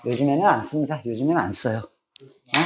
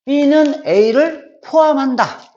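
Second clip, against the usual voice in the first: very slow, raised, word by word; slow reading aloud; 7 semitones higher, 3 dB louder, much slower than usual. Shown as a demonstration. Teacher to students, reading the subset symbol, slow and raised. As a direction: read it out like a model sentence. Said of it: "이렇게 읽을 수 있겠지".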